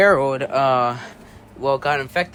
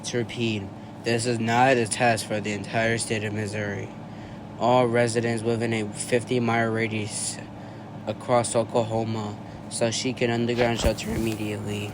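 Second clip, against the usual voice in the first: emergency broadcast; 4 semitones lower than usual. Acted. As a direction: read it like an emergency siren broadcast.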